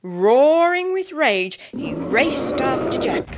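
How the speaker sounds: tense chase telling; urgent narration. A narrator's reading.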